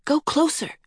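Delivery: put-on high child's voice, urging on. A narrator's reading. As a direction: press the horse onward in a high, light voice. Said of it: "she urged Horse".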